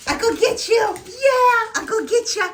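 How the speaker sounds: high, loud playful squeal. Real, not acted. Mom chases her excited dog, high playful squeals. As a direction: rile the dog up with glee.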